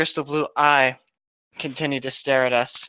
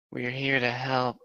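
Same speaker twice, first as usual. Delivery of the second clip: soft reassurance; 7 dB quieter than usual. Acted. Jenna reassures griffin.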